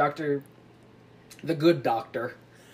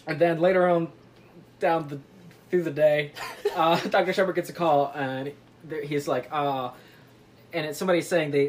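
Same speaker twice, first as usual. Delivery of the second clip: ordinary delivery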